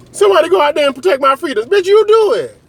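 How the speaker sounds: high-pitched comic indignation; hammed-up exasperated yelling; mock outrage. Acts out an outraged exchange.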